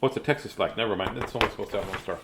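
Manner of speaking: ordinary delivery, nothing marked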